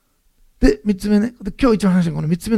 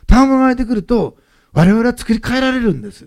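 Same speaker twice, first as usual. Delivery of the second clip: 賜物 hit higher and louder again, then held up through the line; emphatic repeat; 5 semitones higher, 5 dB louder than usual. Real, not acted. Preacher pressing the point home, loud emphatic stress on 賜物. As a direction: repeat it with the same weight, stress on 賜物.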